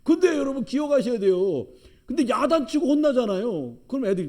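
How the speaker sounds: loud, high preaching push; fiery preaching. Acted. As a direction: press the point on the congregation. Preacher presses a point.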